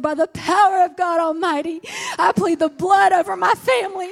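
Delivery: high, loud, shouted pleading of the blood; fervent shouted prayer of protection. Retold or imitated. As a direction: cry it out with authority. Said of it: "I said this prayer".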